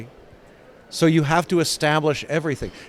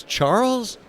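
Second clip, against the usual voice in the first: higher, pointed call of the name straight to camera; 8 semitones higher than usual. Retold or imitated. Carol turning to the audience, knowing, pointed. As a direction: turn to the audience and land the name. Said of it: "looks out of the camera and says".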